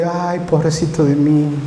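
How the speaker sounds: slow, mock whimpering self-pity; mock self-pity, drawn out; self-pitying whine. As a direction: whine like a defeated victim, slowly. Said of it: "quedarnos en el suelo y".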